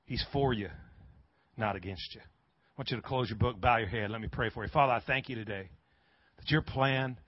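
low, soft closing prayer; hushed pastoral tone; gentle altar call. Real, not acted. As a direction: bring it down quietly, leading into prayer.

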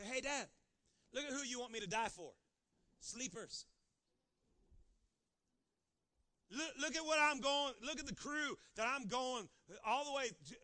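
loud, whiny complaint to his father; complaining to Dad. Acted.

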